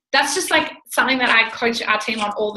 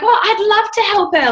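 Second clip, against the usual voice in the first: excited; 9 semitones higher, 4 dB louder than usual. Retold or imitated.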